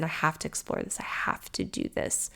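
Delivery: fading down to a whisper; whispered resolve. Retold or imitated. Telling herself she must join, whispered. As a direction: breathe the vow to yourself.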